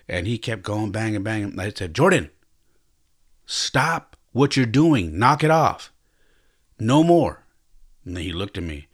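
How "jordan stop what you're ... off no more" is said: firm, slow, raised parent voice; stern scolding. Retold or imitated. Father to his banging toddler son, stern and slowed. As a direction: lay down the law to the toddler.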